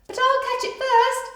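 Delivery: high, loud boastful dog voice; a dog determined to win; boastful third dog; competitive dog. Acted. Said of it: "thought the third dog".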